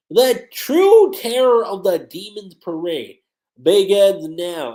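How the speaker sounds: slow, loud dramatic declaration; villainous proclamation. Acted. Yoko unleashing the Demon's Parade, grandly menacing. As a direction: drag out each word with booming menace.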